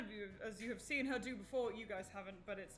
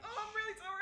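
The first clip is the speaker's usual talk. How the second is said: mock apology; 11 semitones higher, faster than usual. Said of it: "You're like".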